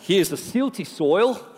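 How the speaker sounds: jokey put-on Australian accent; playful Aussie impression with stretched vowels. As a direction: ramble on like a mock Australian.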